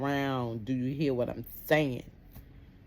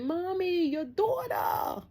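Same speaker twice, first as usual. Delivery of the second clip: much higher crying shout, slow; urgent neighbor; 4 dB louder, much slower than usual. Retold or imitated. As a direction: cry out about the daughter. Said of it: "They said".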